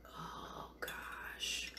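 breathless whisper of delight; whispered awe. Real, not acted. Tasting her dish, a stunned whispered oh gosh. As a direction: whisper it like the taste stunned you. Reacting to first bite.

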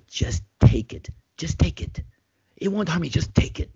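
hushed, high, breathless bully pushing pills; imitating a TV after-school bully. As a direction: lean in like a schoolyard bully pushing something on a kid. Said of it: "a bully in an after-school special".

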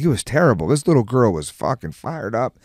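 normal talking voice

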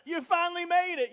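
high, loud mock teasing; retold laughing jeer. Patrolmen pointing at the straggler, high imitated laughing jeer. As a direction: call it out high, as if laughing at someone. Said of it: "they're laughing and pointing at me like".

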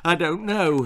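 ordinary delivery, nothing marked

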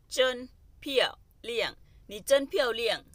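slow, clear model sentence. Shown as a demonstration. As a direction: say it slowly so students follow. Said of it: "slow model of phrase". (พูดช้าๆ ชัดๆ ให้นักเรียนตาม)

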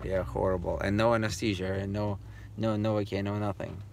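ordinary talk

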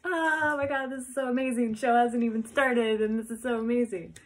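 high, loud excited squeal; ecstatic gushing. Retold or imitated. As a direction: gush breathlessly to a best friend. Gushing to best friend by phone.

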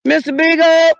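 loud, high, quick call; calling for the dog. Real, not acted. Owner calling lost dog in the woods, quick loud call. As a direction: snap the name out loud and high.

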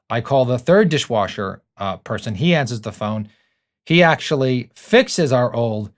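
ordinary talk, nothing marked

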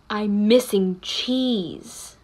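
very slow deadpan. Acted.

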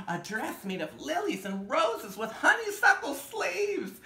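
put-on eager child. Bailey voiced, eager.

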